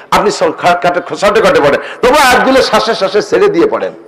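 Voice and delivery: ordinary talk, nothing marked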